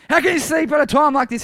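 high, loud indignant shout. Acted. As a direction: shout it at a sleeper in disbelief. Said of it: "he shouted".

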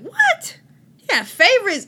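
high, loud, laughing shock; stunned disbelief. Retold or imitated. Friend says God has favorites, shocked high cry.

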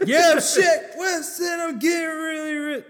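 high yelled rapper impression; [name] shout. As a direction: yell it like a hype rapper.

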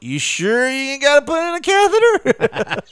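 loud, high-pitched comic voice; mock questioning. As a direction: put on a high, incredulous character voice. Jokingly acts out a dental-patient exchange.

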